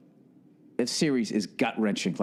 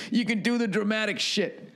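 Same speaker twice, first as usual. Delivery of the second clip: a hint of the dad voice, a bit higher; lightly voiced dad; 8 semitones higher than usual. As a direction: quote dad half in your own voice. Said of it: "That's what he said".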